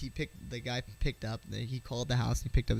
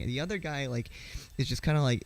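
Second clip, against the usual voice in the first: usual speaking voice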